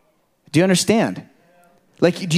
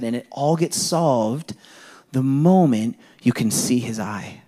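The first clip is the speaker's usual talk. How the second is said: ordinary delivery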